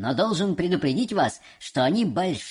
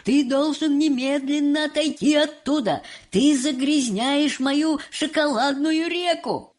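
higher, louder alarmed scolding; urgent scolding; 7 semitones higher, 3 dB louder than usual. Acted. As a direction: order him away in alarm. Wonka scolds boy.

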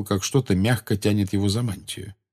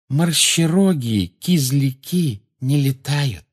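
slow, higher-pitched dignity; stately, word-by-word correction; dignified; 6 semitones higher, much slower than usual. A narrator's reading. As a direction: pronounce it with measured self-importance. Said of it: "сказала Полумна с достоинством".

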